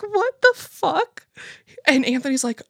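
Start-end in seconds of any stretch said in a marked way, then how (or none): 0.0-1.3 much higher, slow, squealing disbelief; baffled shriek. Retold or imitated. Reacting to the neighbor calling Obama a shapeshifter, a slow high shriek of disbelief. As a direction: stretch it out in a shocked high squeal. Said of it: "I'm like".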